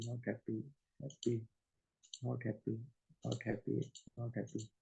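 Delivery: muttered labels under breath. Real, not acted. Labels fish under breath while clicking.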